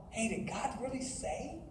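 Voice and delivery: quiet nagging voice of doubt. Acted. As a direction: drop to a hushed inner question. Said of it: "you're gonna sit there and doubt".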